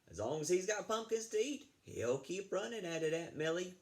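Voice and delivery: lifted voice for a rancher. Acted. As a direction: pitch it up for another rancher.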